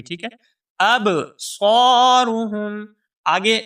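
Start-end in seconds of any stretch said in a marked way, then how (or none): 1.0-2.9 أَبْصَارُهُمْ with a thick, heavy ص after a bouncing ب; heavy sad. Shown as a demonstration. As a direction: make the ص in أَبْصَارُهُمْ full and heavy. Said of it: "اس صاد کو ہم موٹا کر کے پڑھیں گے". (أَبْصَارُهُمْ میں صاد موٹا)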